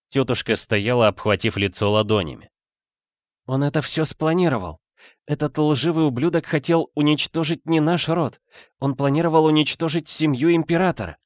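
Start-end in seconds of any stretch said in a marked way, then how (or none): 3.4-11.2 higher-pitched agitated woman's voice; distressed aunt. A narrator's reading. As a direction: accuse him in dismay, without shouting. Aunt's horrified accusation, voiced by narrator. (взволнованная тётушка, высоким голосом)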